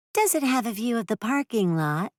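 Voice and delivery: high, sing-song hopeful question. A narrator's reading. Renter asking Bolin about the view, high and flirty. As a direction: ask it hoping to see him again.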